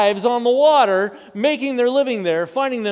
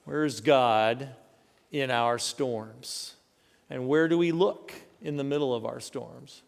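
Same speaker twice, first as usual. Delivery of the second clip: reflective question; 7 semitones lower, 6 dB quieter than usual. Real, not acted. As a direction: let the question hang quietly.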